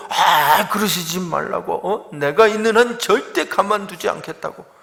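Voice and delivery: loud, high imitation of cocky protest; blustering, overconfident retort; arrogant big talk. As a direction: act out an overbearing objection. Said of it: "이게 아주 교만하게 말하는 겁니다".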